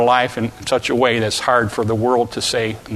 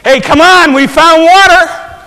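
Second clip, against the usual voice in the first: loud, high holler over distance; big, high-pitched relay yell; calling back; 11 dB louder than usual. Acted. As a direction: shout back to those behind you. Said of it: "he turned back and said".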